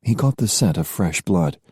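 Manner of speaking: ordinary delivery, nothing marked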